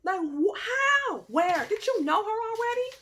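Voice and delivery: high, loud, near-crying outburst; frantic questions. Retold or imitated. A high, loud cry at the ex's new wife. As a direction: fire off questions, shrill with hurt. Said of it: "I was like".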